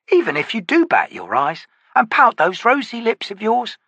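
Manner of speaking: lightly voiced teasing man; mocking rogue. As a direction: taunt her with sly glee. Harlow taunts Stonny.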